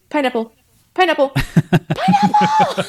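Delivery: rising panicked cry, last one stretched high and laughing; panicked safe word. Retold or imitated. Ross with a tarantula on his arm, mock panicked squeal. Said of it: "he's like".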